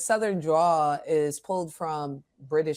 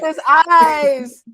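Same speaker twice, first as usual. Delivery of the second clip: loud, high, drawn-out cry; excited exclamation; 9 semitones higher, 10 dB louder than usual. Real, not acted. Joking with co-hosts about Cyclops missing, excited exclamation.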